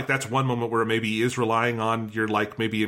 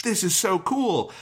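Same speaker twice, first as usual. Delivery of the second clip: slow, higher, wide-eyed wonder; awed kid; 7 semitones higher, slower than usual. Retold or imitated. Recalling watching as a kid, slow awed gush. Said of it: "I was like".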